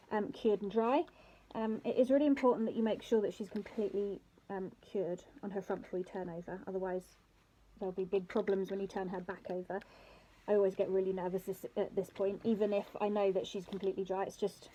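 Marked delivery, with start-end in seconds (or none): none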